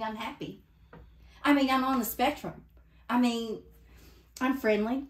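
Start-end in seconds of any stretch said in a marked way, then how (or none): 1.4-5.1 flustered. Acted. Flustered self-correction.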